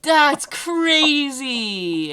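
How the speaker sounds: high, slow stretched-out "that's crazy"; drawn-out disbelief. Real, not acted. Reacting to ceiling collapse story.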